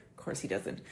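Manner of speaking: breathy, dropped-volume whisper; whispered aside. Real, not acted. Vlogger whispering a snarky aside about the man. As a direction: mutter it to yourself, under the breath.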